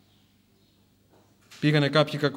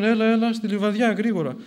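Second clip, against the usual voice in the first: raised, louder impatient command; urgent ordering; 6 semitones higher, 5 dB louder than usual. Acted. Soldiers to the wounded saint they are taking away, urgent ordering. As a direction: order the prisoner to get moving.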